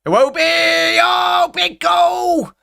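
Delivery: sudden high, loud shout at the goal; goal celebration shout. Real, not acted. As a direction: yell it out as the ball goes in. Goal scored during live watchalong.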